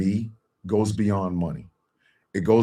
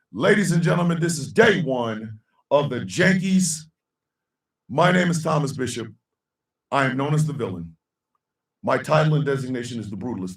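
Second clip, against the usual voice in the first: higher, ringing ring-announcer voice; announcer intro; 5 semitones higher than usual. Acted. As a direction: introduce yourself like a wrestling villain. Announcer-style self introduction.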